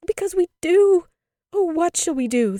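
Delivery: put-on very high, loud childish wail; cried out. A narrator's reading. Two children answer their mother, crying out. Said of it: "they cried together".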